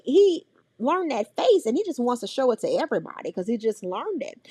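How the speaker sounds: ordinary talk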